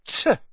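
sharp, high-pitched tut of impatience; impatient tut. A narrator's reading. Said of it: "There was a loud and impatient".

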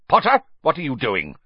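loud, high, sudden demand; sharp challenge. A narrator's reading. Snape catching Potter behind the cauldron, sharp and loud. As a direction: call him out sharply and loudly. Teacher catches pupil eavesdropping.

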